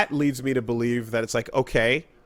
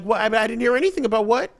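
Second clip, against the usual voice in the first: baffled; 10 semitones higher, 4 dB louder than usual. Retold or imitated. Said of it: "So I'm like".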